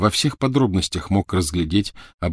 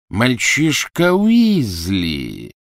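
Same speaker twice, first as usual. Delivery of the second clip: very slow, raised, loud exclamation; crying out; 4 semitones higher, 5 dB louder, much slower than usual. A narrator's reading. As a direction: stretch the discovery into a cry. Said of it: "вскричал Малфой-старший". (протяжный громкий возглас)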